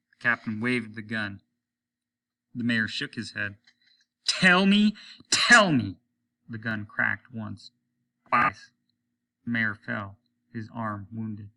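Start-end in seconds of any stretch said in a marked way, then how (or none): 4.3-6.0 very loud, high yell; frantic furious scream. A narrator's reading. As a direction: scream it waving the gun. Captain screams with gun.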